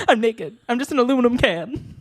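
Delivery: high, quick talking soda can. Pretend. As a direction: play a chatty empty soda can. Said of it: "I'm doing my soda can voice".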